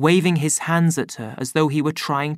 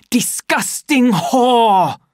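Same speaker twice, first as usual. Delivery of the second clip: slow, loud, high furious outburst; raging curse; 10 semitones higher, 6 dB louder, much slower than usual. A narrator's reading. Savage pacing and cursing in anger. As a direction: snarl it with disgust.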